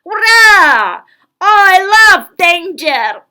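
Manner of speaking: big firm shout, high and slow; loud and firm. A narrator's reading. Girl springs up before the couple, loud firm cry. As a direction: proclaim it boldly to the room. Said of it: "in a loud and firm voice".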